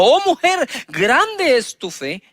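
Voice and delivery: Jesus exclaiming to the woman, slowed at the end; awed praise. Acted. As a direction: marvel at her faith, then let it land slowly.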